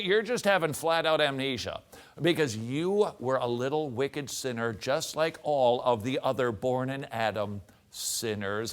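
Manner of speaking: usual speaking voice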